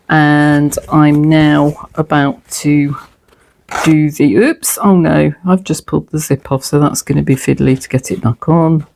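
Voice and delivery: usual speaking voice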